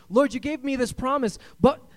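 high, loud exasperated complaint; frustrated believer. Retold or imitated. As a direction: whine at God that nothing happens. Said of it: "We're going".

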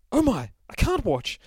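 high, loud prissy dismay; a delicate narrator recoiling in horror; squeamish narrator. Acted. Narrator flinches at the violence, high prissy dismay.

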